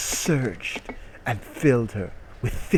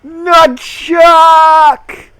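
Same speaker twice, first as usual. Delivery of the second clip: very high, loud shout; hammed-up signature yell; catchphrase shout; 16 dB louder than usual. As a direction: bellow the catchphrase.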